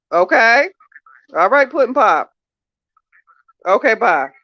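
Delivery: high, loud, fed-up telling-off; loud scolding. Real, not acted. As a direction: lay down the law to a tipsy friend. Scolding tipsy friend on phone.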